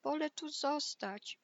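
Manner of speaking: high, small piggy voice; timid little reply. Acted. Lazy pig refuses the wolf from inside, meek high voice. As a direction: answer meekly, lightly voiced and high. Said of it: "odparła cichutko świnka".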